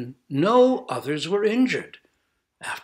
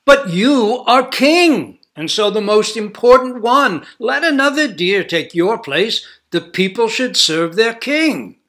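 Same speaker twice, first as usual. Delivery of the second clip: higher, louder voice of a commanding king; human king; 8 semitones higher, 10 dB louder than usual. A narrator's reading. Human king urges the deer to send another, insistent. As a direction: press him like an insistent king.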